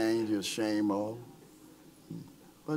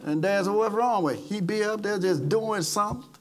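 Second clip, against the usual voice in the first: higher, louder preacher's build; preaching at full force; 6 semitones higher, 4 dB louder than usual. Acted. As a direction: preach it out to the congregation. Pastor preaching his testimony.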